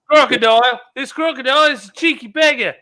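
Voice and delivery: high-pitched Australian accent impression; hammed-up Aussie croc-hunter excitement; Steve Irwin. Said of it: "it's 'cause we had Steve Irwin".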